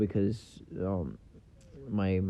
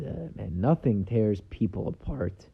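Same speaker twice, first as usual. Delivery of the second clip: ordinary talk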